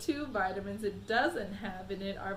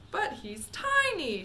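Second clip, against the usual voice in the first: slow, higher shout; a father shouting in shock; 10 semitones higher, 5 dB louder, slower than usual. A narrator's reading. Father shouting at the tiny boy on screen. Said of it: "shouted Mister T V".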